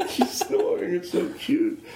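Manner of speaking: high, laughing whisper over the sleeping dog; amused coo. Real, not acted. Cooing over snoring dog.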